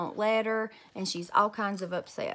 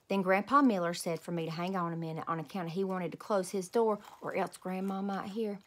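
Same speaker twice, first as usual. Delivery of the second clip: ordinary delivery, nothing marked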